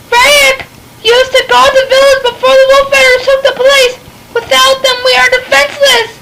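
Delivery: high, desperate begging; pleading villager. Acted. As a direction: beg her not to go. Villager begs Lita to stay.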